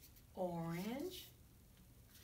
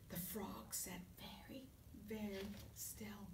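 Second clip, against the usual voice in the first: hushed suspense whisper; whisper, keep very still; 2 semitones lower, 11 dB quieter than usual. Acted. Suspense before the snap.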